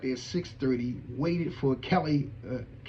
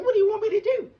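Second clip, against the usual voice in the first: high, louder, can't believe it; baffled outburst; 6 dB louder, faster than usual. Retold or imitated. Asking the mentor what he wants, in high disbelief. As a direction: throw the question up high.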